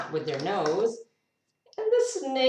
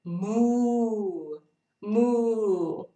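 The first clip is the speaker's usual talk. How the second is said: long drawn-out cow moo, louder; 4 semitones higher, 4 dB louder than usual. Pretend. Reader showing a child the cow's sound, mooing. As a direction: let out a big rounded moo like a cow. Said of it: "What sound does a cow make?".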